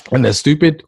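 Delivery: usual speaking voice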